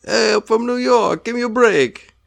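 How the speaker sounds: very high-pitched New York accent; silly New York accent way up high. Shown as a demonstration. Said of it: "all accents have to start with the city".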